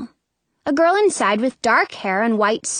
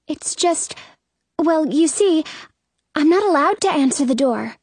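put-on higher, hesitant girl's voice; 6 semitones higher than usual. A narrator's reading. Girl inside refusing the door, hesitant. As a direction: explain reluctantly, pitched up.

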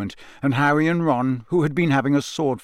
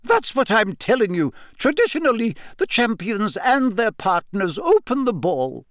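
higher, insistent teacher; a hint of impatience; lightly voiced McGonagall; 8 semitones higher than usual. A narrator's reading. McGonagall explaining tradition to Harry, impatient teacher voice. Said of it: "McGonagall insisting".

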